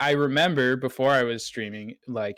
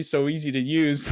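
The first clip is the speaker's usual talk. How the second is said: slow, drawn-out sarcastic jab; mock envy, dripping with sarcasm; 2 semitones higher than usual. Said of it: "where I get very sarcastic".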